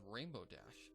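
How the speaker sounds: ordinary delivery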